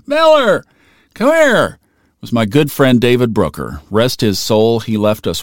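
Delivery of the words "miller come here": high, loud call to come over; hollering. Retold or imitated. Friend calling him over across campus, loud high holler. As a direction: shout it across the quad to get him over. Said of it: "David Brooker hollering at me".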